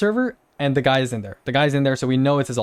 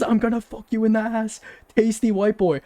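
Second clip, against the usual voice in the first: higher mock voice reading his message; 6 semitones higher than usual. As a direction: ridicule the troll's words in a higher voice. Mocking the troll's message.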